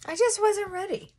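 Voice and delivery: emotional. Real, not acted.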